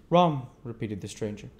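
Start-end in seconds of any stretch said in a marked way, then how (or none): 0.1-0.5 higher, louder stranger's voice. A narrator's reading. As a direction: voice the odd stranger, curious. Stranger repeats the order.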